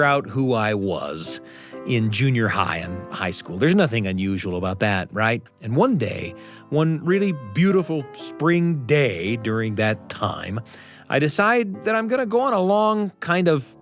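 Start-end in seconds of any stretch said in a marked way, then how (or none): none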